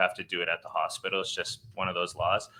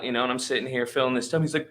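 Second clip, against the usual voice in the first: ordinary talk, nothing marked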